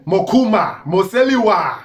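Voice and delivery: proudly hailing his Lomwe roots; proud call-out. Real, not acted. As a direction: call the names out like a rallying cry. Proudly calling out his tribe's names.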